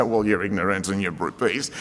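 a hint of a scolding friend; mock blunt insult. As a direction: play the tactless counsellor, half in jest. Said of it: "you don't say".

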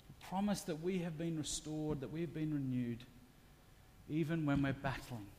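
quiet, tender preaching voice; soft sermon. Acted. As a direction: bring it down to a quiet confession. Soft reflective sermon passage.

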